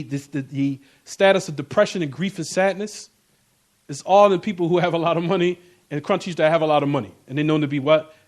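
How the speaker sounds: ordinary delivery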